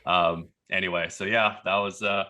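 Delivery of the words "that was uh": ordinary talk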